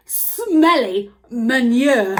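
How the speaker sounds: slow, loud "smelly manure"; drawn out. Shown as a demonstration. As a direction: stretch "smelly" out. Exaggerated teaching stress.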